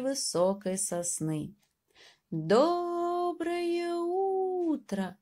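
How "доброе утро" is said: very slow, high drawn-out call. Acted. Mouse calling up to the bird, high and stretched. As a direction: stretch it up to the treetop. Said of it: "крикнул мышонок".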